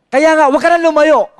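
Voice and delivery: high, loud exhortation to the congregation; rising preacher's cry; preaching shout. Real, not acted. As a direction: drive it home at full force.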